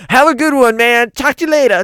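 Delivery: high, loud farewell; loud sign-off. Acted. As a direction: shout goodbye.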